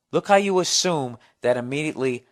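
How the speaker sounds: ordinary talk, nothing marked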